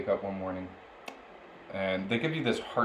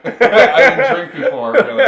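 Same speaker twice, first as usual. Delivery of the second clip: laughing aside; 12 semitones higher, 14 dB louder than usual. Real, not acted. Laughing about not drinking.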